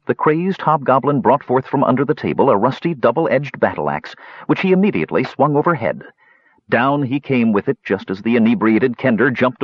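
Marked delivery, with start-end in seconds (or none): none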